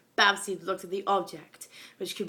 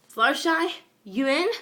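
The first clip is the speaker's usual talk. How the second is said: louder, higher call into the house; 8 semitones higher, 8 dB louder than usual. A narrator's reading. Pegasus calling into Fluttershy's dark house, loud. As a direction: call through the cracked door. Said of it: "Fireblast asked".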